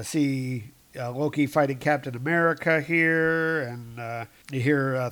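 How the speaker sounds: normal talking voice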